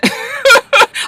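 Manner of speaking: quick high giggle; a short amused cackle; laughing at the departing president. Real, not acted.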